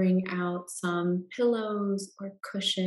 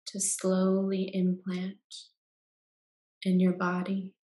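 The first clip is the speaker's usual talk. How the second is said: guided meditation. Acted. Guide leading listeners into Yoga Nidra rest, calm meditation voice.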